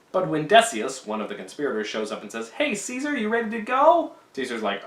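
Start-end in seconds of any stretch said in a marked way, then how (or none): 2.5-4.1 higher, breezy call to a friend; chirpy caller. Retold or imitated. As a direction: pop in brightly to fetch him. Decius calls on Caesar.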